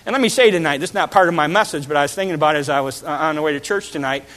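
ordinary talk

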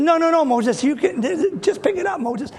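high coaxing with a laugh. Acted. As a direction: wheedle Moses into it. God pleading with Moses, laughing.

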